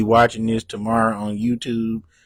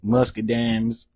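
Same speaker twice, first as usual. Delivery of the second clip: ordinary talk, nothing marked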